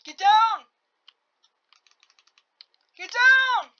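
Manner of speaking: high, loud commanding yell; shouting orders. Real, not acted. Gamer yelling at a character to take cover, loud. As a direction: bark the order at him again and again.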